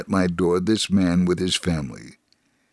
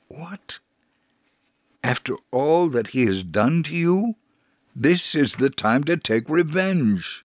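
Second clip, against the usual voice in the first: a sharp, much higher cry of disbelief, slowing on the last line; shocked exclamation; 7 semitones higher than usual. Retold or imitated. Coworker exclaims in disbelief at the blood offer, shocked and high. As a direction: gasp it out in outrage. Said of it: "The other man exclaimed".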